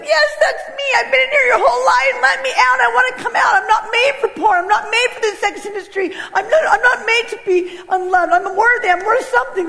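put-on very high, urgent child voice; freaking-out little girl. Inner child begging to be let out, high and frantic. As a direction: squeal it out like a child bursting free. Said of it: "little girl in me started freaking out".

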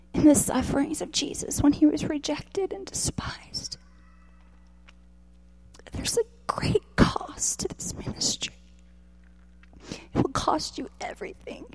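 soft, near-voiceless words through tears; tearful whisper. Real, not acted. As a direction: hold back the sobs and whisper it. Speaker weeping while preaching.

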